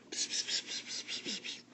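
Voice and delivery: soft unvoiced psst psst; children whispering psst; whispered psst. A narrator's reading. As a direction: hiss little whispers. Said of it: "The children began to whisper".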